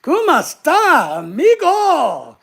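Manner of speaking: loud, high, drawn-out mock Spanish accent; put-on Spanish accent. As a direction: ham up a Spanish accent, stretching each word. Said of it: "Why are you doing that accent?".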